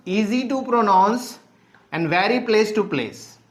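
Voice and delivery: key points read out very slowly; slow dictation. Real, not acted. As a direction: stretch each word out for students taking notes. Teacher dictating key points to class. (धीरे-धीरे लिखवाते हुए)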